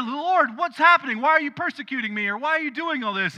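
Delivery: high, loud panicked plea to the Lord; alarmed cry. Acted. Blinded Paul crying out to the Lord, high and loud in alarm. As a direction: cry out struck blind and scared.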